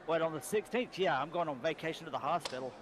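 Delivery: ordinary talk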